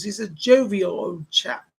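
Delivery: put-on light British accent, slow and relaxed on "jovial old chap"; a hint of an old British gent. Medium relays a jolly spirit, in a light British accent. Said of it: "That's how he says it".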